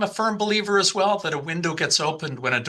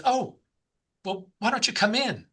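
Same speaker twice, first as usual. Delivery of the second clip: higher-pitched British woman's voice; British accent; 7 semitones higher than usual. Retold or imitated. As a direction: play her inviting, in a British accent. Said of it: "in a British accent".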